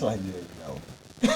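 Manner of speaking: deep sexy voice. Retold or imitated. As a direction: drop into a smooth deep voice. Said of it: "you put that deep voice on".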